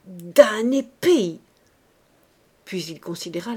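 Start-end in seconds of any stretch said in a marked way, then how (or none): 0.0-1.4 loud, slow, drawn-out curse; frenzied muttered curse. A narrator's reading. Man fetches bandages and bottle, cursing in frenzy. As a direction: curse with each word heavily leaned on. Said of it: "en murmurant avec frénésie".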